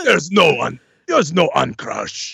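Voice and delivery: slow, heavy brute line; Crusher voice. Acted. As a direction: deliver it like a dim giant. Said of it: "in character as Crusher".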